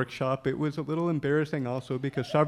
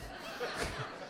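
breathy laugh; 12 dB quieter than usual. Real, not acted. Recalls people doubled over laughing.

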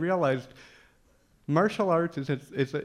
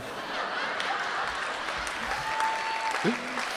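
airy laugh. Real, not acted. Laughs at own story.

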